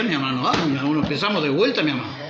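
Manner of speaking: ordinary talk, nothing marked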